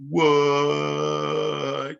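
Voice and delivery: loud, sung disbelief; stunned what. Retold or imitated. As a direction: make the shock sound almost musical. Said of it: "And you're like".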